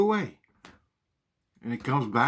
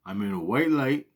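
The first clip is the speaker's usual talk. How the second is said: usual speaking voice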